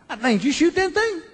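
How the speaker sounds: high, clueless voice; dopey neighbor. Retold or imitated. Neighbor asks about the elk head, dopey high voice.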